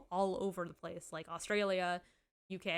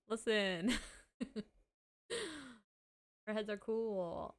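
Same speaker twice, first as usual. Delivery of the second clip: normal talking voice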